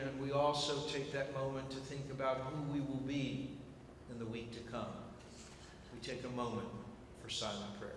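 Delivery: soft, low, unhurried prayer voice; prayer leading. Acted. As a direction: hush the room into a moment of prayer. Leads a quiet moment of prayer.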